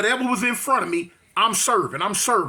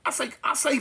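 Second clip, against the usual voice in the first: ordinary talk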